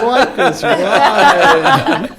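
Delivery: high, loud, slow stoned cheer; dazed excited woo. Retold or imitated. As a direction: cheer it out, blissed and dreamy. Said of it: "people came out of there going".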